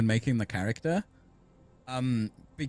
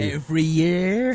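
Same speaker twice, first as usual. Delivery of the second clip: West Country accent; 4 semitones higher, 7 dB louder, slower than usual. Shown as a demonstration. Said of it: "West Country accent".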